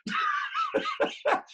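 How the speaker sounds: high shouted laugh; laughter. Real, not acted. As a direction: shriek with laughter. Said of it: "laughter at the surprise fight".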